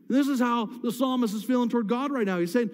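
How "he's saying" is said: ordinary delivery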